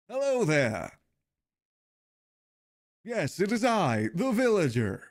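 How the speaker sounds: hammed-up grinning villager greeting. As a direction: talk like you're stuck in a permanent big grin. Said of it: "speaks like he's always got a permanent big grin".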